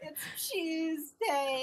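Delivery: laughing, drawn-out imitation British accent; mock English. Friend echoing the too-English voice, mock British. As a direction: stretch it out in giggly mock British.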